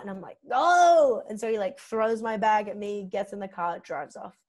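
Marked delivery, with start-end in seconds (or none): 0.5-1.2 loud, high mock yell; retold yell. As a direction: yell it at the driver, acting out the scene. Yells at the driver in retelling.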